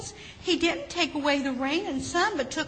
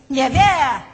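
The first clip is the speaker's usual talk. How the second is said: much louder storybook never; shouted; 2 semitones higher, 11 dB louder than usual. A narrator's reading. Beans refuse the bush, loud storybook shout. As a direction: shout never with a stubborn punch. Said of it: "shouted the beans".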